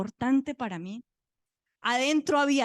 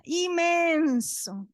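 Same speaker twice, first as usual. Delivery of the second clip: "inmenso" hugely stretched, high and loud; loud, high, long-held "inmenso"; exaggerated emphasis; 6 semitones higher, 6 dB louder, much slower than usual. Real, not acted. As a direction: draw out "inmenso" to show enormous size. Exaggerating the size of the diaper.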